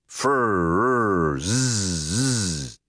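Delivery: long, wavering motor buzz; voiced electric vibrating hum; buzzing machine. A narrator's reading. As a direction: draw it out like a whirring helmet. Narrator imitates the buzzing helmet.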